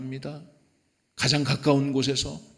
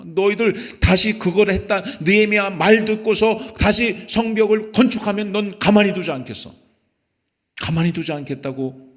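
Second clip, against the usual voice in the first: loud, high threat; acted-out menace, pitched up and pressing; threatening; 8 semitones higher, 5 dB louder than usual. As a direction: warn them off forcefully, voice raised. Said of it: "위협을 합니다".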